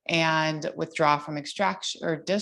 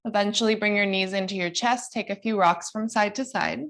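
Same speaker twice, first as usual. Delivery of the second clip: higher, gentle guiding cues; yoga instructor voice; 4 semitones higher than usual. Acted. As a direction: lead the class through poses calmly.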